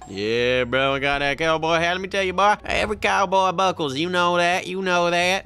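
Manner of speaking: lightly drawled Southern cowboy talk; loud, hammy Southern cowboy brag; southern cowboy accent. Pretend. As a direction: play the swaggering Southern cowboy. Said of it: "No more southern accents".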